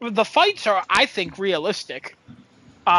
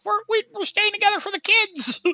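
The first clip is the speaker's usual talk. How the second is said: mock parents; 12 semitones higher than usual. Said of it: "And they're like".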